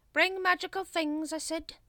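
high, loud, irritated order; exasperated repeat. A narrator's reading. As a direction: repeat the instruction at underlings in exasperation. Grand Master angrily repeats order.

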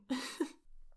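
light breathy giggle; little playful laugh. Real, not acted. Giggle after playful reply.

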